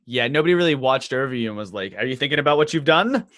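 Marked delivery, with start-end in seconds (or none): none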